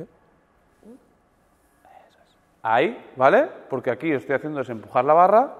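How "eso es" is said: whisper. Real, not acted. Coach quietly approves student.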